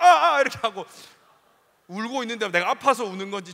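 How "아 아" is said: loud, high yelps of pain; pained cries. Retold or imitated. Boy hit during prayer, crying out in loud pain. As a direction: yelp out as the ring strikes bone.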